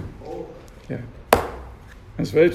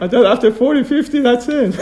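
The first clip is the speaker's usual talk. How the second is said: ordinary talk, nothing marked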